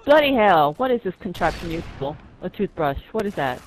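loud fake British voice; mock British accent. Gamer mocks the British player in a loud fake British accent. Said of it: "mocking British player".

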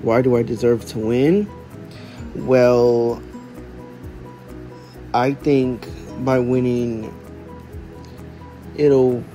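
ordinary talk

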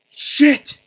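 loud, high cursing shout; high frightened outburst; startled yell. Real, not acted. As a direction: yell it out in shock. Reacting to being caught.

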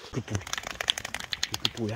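usual speaking voice

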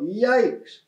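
high-pitched mock yell, no real volume; storybook yell of alarm; yelped exclamation. A narrator's reading. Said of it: "turned around and yelled".

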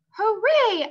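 loud, high "Hooray!"; a kid cheering at dinner; excited cheer. A narrator's reading. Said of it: "I said".

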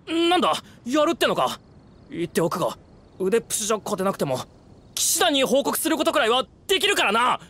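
high-pitched, rattled threat; stammering start, then high defiant protest; alarmed defiance. Acted. Frightened man threatens in a quarrel.

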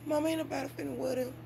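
put-on high, quick, whiny kid voice; a kid scoffing at cheap sandals; mocking kid. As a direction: sass it like a teen brushing off mom. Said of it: "Soon as they get with me".

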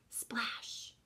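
breathy whisper on splash; hushed whisper splash. A narrator's reading. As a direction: whisper it like a soft splash far off.

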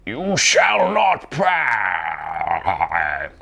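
hammed-up wizard roar, hugely drawn out; slow, over-the-top wizard cry; Gandalf. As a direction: stretch each word like a wizard's command. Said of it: "Please do a Gandalf impression".

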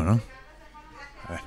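usual speaking voice

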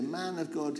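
ordinary talk

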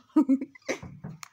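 real laugh. Real, not acted. Laugh after declaration.

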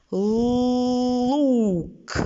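лук, у drawn out; stressed U. Shown as a demonstration. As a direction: stretch the у in лук. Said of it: "вимовте так, щоб всі почули".

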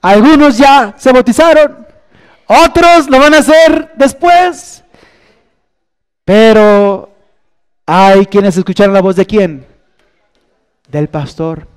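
high, loud call to respond; preaching at full force. Acted. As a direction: urge the listeners to answer. Llamado final de la predicación. (llamado ferviente, alto y fuerte)

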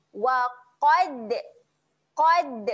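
Qad with a loud bouncing d at the end; bouncing dal. Shown as a demonstration. Teacher shows students the qalqalah on qad, loud. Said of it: "kita kena lantun".

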